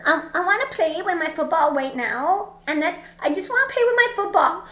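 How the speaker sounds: put-on high, whining man-child voice; mock him like a sulky little boy; mocking the guy as a whiny kid; whiny childish guy.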